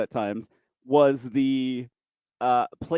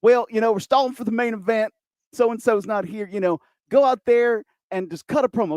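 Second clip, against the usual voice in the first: higher-pitched promoter with a put-on accent; exaggerated accented promoter, pitched up; promoter's pronounced accent; 6 semitones higher than usual. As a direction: imitate the promoter, accent laid on thick. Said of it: "accent was not that pronounced".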